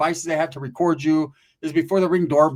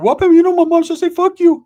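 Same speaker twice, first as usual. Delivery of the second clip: put-on much higher, louder whiny kid; 13 semitones higher, 11 dB louder than usual. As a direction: play a squeaky little boy. Voicing Ricky as a kid.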